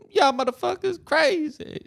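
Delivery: very high, loud, drawn-out caricature; hammed-up high mocking voice; mocking politician impression. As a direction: squeal it out as an exaggerated code-switched put-on. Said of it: "now she talks like".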